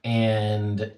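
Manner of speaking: usual speaking voice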